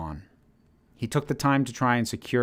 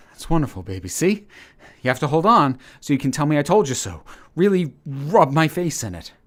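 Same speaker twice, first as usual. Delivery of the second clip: higher and louder, choked with tears; tearful excitement; 5 semitones higher, 6 dB louder than usual. A narrator's reading. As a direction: cheer her on through tears.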